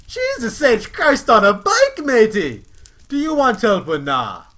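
very high, very loud yelling, slow at first; exasperated squeaky bear. Acted. Kyle the bear loses patience with the prince, very high and loud. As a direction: bellow it in frustration.

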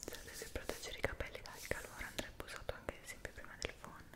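ordinary delivery

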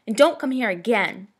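calling after. A narrator's reading. Bully warns off running girl, calling out. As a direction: call it after someone fleeing. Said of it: "She could hear Dicey call after her".

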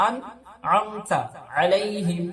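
slow, আনআমতা with হামজা and a clear আইন; hamza then ain. Shown as a demonstration. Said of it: "আন প্রথমটা হামজা, তারপরে একটা আইন". (হামজা আর আইন স্পষ্ট)

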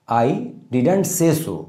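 very slow, each word spaced out; slow dictation. Shown as a demonstration. Teacher reading the example slowly to students, very slow. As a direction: spell it out word by word for the class. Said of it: "ये past and definite है".

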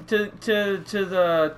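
usual speaking voice

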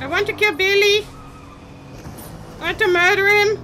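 hungry for the kill; excited. Real, not acted. Gamer eager to kill Billy.

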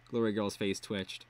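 ordinary delivery, nothing marked

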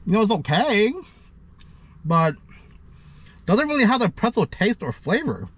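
speaking between chews; chewing while talking. Real, not acted. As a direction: mouth partly full. Seen 2: Bites crust at -5; chewing expressions and hand at mouth throughout.

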